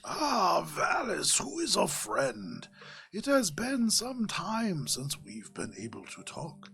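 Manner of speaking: lilting, ceremonious mentor voice; slow-talking mystic Javaris. Acted. Javaris greets Valis through the prayer beads, slow-talking mystic.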